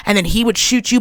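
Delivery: ordinary talk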